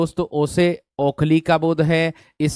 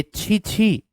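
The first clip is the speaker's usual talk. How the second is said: demonstrated "chhi chhi" of disgust, second one higher; 5 semitones higher, much slower than usual. Shown as a demonstration. Teacher showing students how disgust sounds in intonation, disgusted. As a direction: say it as a demonstration of revulsion. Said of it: "घृणा के तौर पर मैं कह दूंगा".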